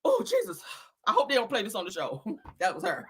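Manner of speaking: ordinary delivery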